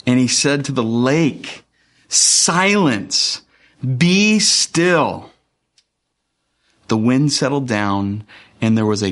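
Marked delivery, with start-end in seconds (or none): 2.1-5.3 high-pitched, drawn-out order to the storm; firm command. Acted. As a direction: command it with authority, leaving space between orders. Quotes Jesus commanding the storm.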